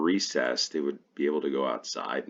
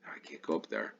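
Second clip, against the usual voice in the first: muttered aside; 9 dB quieter than usual. Real, not acted.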